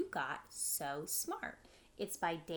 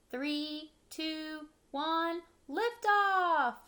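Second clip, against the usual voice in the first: rising excited countdown to a shout; excited rocket countdown, louder each number; 7 semitones higher, 7 dB louder, much slower than usual. Acted. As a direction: build up to lift off.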